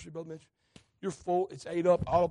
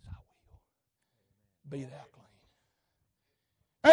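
hushed voice of Jesus; 5 semitones lower, 7 dB louder, much slower than usual. Acted. Preacher whispering Jesus's words to the leper, hushed. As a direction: breathe the words like a holy hush.

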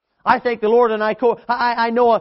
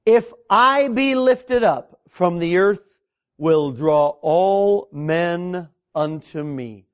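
slow scripture reading; 3 semitones lower, much slower than usual. Acted. Quoting Jesus, slowed.

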